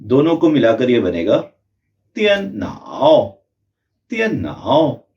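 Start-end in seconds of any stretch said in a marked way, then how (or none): 2.2-3.3 त्यन नाओ drawn out very slowly and higher; slow practice. Shown as a demonstration. Teacher shows the slow practice version. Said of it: "पहले इसको slow बोलने की practice करें". 4.1-5.1 त्यन नाओ said as one word at normal pace; at normal speed. Shown as a demonstration. Teacher models the word smoothly. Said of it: "बिना pause के हमें बोलना है".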